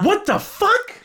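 high, loud, incredulous shout; outraged disbelief. Acted. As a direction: yell it as a baffled question. Outraged at the movie's twist.

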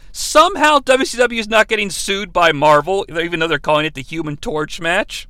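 normal talking voice

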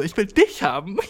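mock-cutesy, rising playful delivery; playfully cute. Imagining a flirt with a friend, playfully cute. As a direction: lightly put on a cute flirty voice. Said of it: "dachte ich so, es wär cute".

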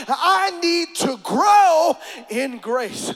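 loud, fervent preacher cadence; preaching. Real, not acted. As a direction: preach it with rising fire. Preaching at full force.

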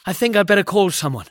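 fast, nervous and urgent; anxious. A narrator's reading. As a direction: rush it out anxiously. Panicky suggestion.

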